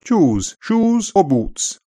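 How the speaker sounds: upper RP "Shoes, shoes or boots." with a slow rise high on "or"; plummy upper RP, drawn out slowly; upper RP accent. Shown as a demonstration. Teacher demonstrating upper RP slowly, in an upper RP accent. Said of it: "remains a back vowel in all places in upper R P".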